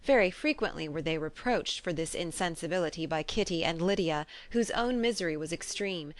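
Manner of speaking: ordinary delivery, nothing marked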